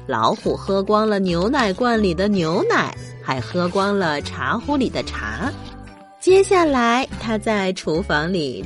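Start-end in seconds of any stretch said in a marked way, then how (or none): none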